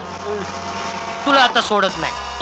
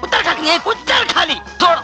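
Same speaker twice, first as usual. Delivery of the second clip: higher, stammering demands for the wallet; frantic pleading; 7 semitones higher than usual. Acted. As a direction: stutter and plead, pitched up. Constable begging thief to come down. (चोराकडे पाकिट मागताना घाबरलेली विनवणी)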